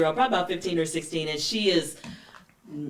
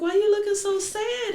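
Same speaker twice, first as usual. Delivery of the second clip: put-on much higher, prying old grandmother; 13 semitones higher than usual. Grandmother questioning the unhappy bride, much higher. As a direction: poke at her with a high nosy question. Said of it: "grandmother's like".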